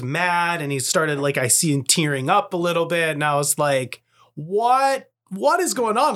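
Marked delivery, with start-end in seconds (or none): 4.4-6.1 high-pitched, bewildered reaction; retold disbelief, voice shooting up; baffled dad. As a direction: act out a parent's astonishment. Dad retelling bewilderment at son's outburst.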